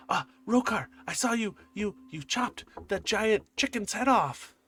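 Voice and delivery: put-on high, loud awestruck child; excited kid. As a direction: blurt it out like a starstruck little boy. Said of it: "he goes".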